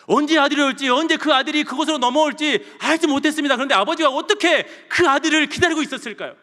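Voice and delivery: loud, high, full-force preaching; 열정적인 설교. Acted. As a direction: press each repetition home with rising fervour. Preaches father's waiting. (크고 높게 몰아치는 설교)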